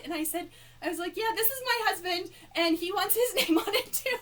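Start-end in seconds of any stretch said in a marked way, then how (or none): 1.2-4.2 higher, laughing through the words; embarrassed giggling. Retold or imitated. Fan explaining her husband wants his name too, giggling.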